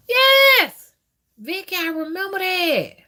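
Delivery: yelped high and loud, then still high; squealed yes to a viewer; excited yes. Real, not acted. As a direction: burst out in recognition. Reacting to a viewer.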